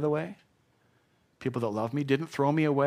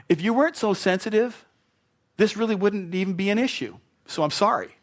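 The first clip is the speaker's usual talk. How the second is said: higher, louder sarcastic half-apology; hammed-up excuse-maker; mock defensive apology; 7 semitones higher, 5 dB louder than usual. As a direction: blame them while pretending to apologize.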